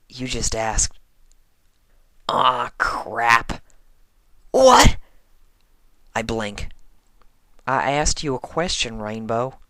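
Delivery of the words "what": high, loud cracking what; startled yelp. Acted. Rainbow startled by the question, high yelp. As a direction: yelp in shock.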